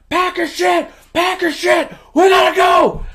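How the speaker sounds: high, loud panicked shouting; hammed-up frantic hurry; frantic yell. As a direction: shout urgent orders, acting it out. Rocco panicking, fleeing apartment.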